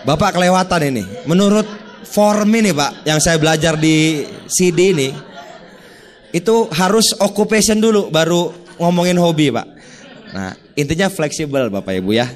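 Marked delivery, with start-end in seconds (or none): 0.0-9.7 hammed-up stiff salesman, giggling as he scolds; laughing mock pedant. Mocking a rigid, by-the-book salesman. (tertawa sambil meniru sales yang kaku)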